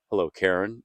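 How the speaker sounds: normal talking voice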